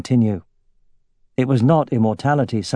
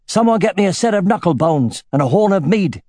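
calling out; 8 semitones higher than usual. A narrator's reading. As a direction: call out the demand. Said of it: "he called".

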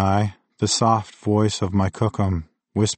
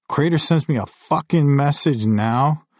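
higher-pitched, pissed-off sneer; angry sneer; 6 semitones higher than usual. A narrator's reading. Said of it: "I sneered, pissed off by the timing".

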